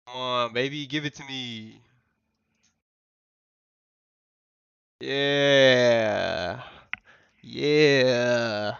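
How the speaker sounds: loud, hyped-up whooping; excited cheering. Real, not acted. Streamer urging his trade on, loud excited cheering. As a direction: urge it on loudly, stretching the cheers.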